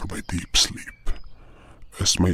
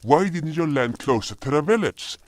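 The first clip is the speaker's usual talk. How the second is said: indignant disbelief; 9 semitones higher than usual. Acted. Outraged at long walk.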